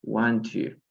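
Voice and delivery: "want to" as two words, T sounded; careful textbook "want to"; separate words. Shown as a demonstration. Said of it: "la pronunciación es want to".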